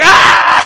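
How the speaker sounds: high, loud unvoiced scream of pain. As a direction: let out a sharp anguished scream.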